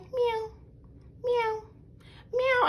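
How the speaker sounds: very high playful kitty meows; imitating kitten meows. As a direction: meow like a little kitten, each one spaced out. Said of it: "And she was like".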